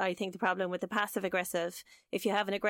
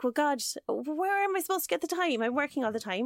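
acted indignant outburst, pitched up; angry; 5 semitones higher than usual. Partner excusing his forgotten dinner booking, angry. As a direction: protest in mock outrage, starting high. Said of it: "eventually he'll get angry".

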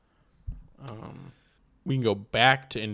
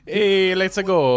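put-on loud, higher New York accent; 7 semitones higher, 7 dB louder, much slower than usual. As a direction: shout it like a New York guy. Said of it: "I'm doing, like, New York".

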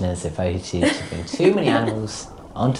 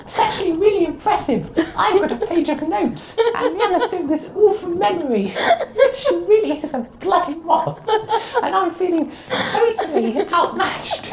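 much higher squeak held through the whole bit, laughing; squeaky high voice. Pretend. Mocking his co-host in a moth-high voice, laughing. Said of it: "speak in really high-pitched voice".

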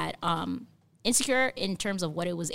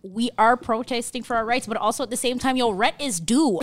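exasperated; 5 semitones higher, 6 dB louder than usual. Acted. Acting out an exasperated ordinary person.

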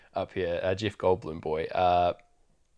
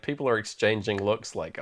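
ordinary delivery

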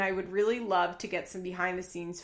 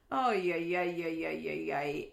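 deadpan sarcasm that trails off; sarcastic yeah; 4 semitones lower than usual. Real, not acted. Host mocking Dimitri's line, sarcastic yeahs.

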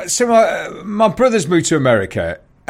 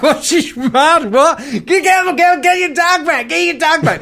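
much higher frantic mock shouting; panicked woman imitation; 12 semitones higher than usual. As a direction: play a frantic woman ordering people about.

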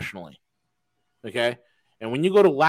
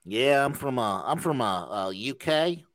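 Tennessee drawl; 4 dB quieter, slower than usual. Retold or imitated. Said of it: "a Tennessee drawl".

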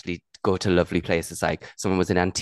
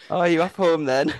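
taxi man voice; 8 semitones higher than usual. Retold or imitated. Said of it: "the man was like".

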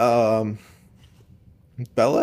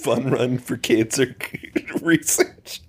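breaking into laughter; slower than usual. Real, not acted. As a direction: crack up before the phrase is finished. Co-host cracks up at own joke.

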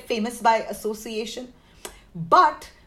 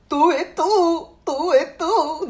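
put-on Indian accent owl hoot; 3 semitones higher, 3 dB louder than usual. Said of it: "I have to do it with an Indian accent".

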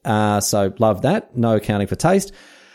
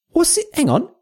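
mock disbelief. Host startled by diary entry.

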